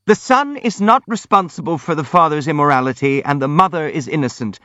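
mildly squealed protest, vehement. A narrator's reading. Hippolyte defends the son and mother, vehemently squealing. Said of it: "squealed Hippolyte vehemently".

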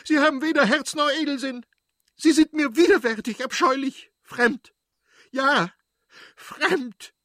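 very high, loud, anguished fury; wife screaming her hurt at her husband; pain and rage. Acted. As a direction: hurl the word fremd at him like a verdict. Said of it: "Mit Schmerz und Wut sprach sie".

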